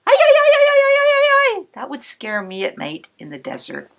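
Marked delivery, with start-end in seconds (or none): 0.1-1.6 high loud yipping hyena cries; hyena screech. Shown as a demonstration. Showing kids the hyena's scary night call, high and loud. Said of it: "kind of like".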